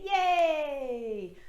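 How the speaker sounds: high, loud joyful cheer; childlike whoop of delight; cheerful yay. Shown as a demonstration. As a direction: cheer it out with arms up. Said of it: "throw your arms up and say".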